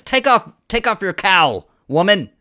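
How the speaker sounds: loud, acted confrontation; scowling demand. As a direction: order her sternly as an accuser. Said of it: "he scowls and he says".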